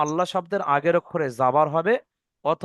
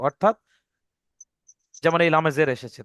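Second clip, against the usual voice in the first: ordinary talk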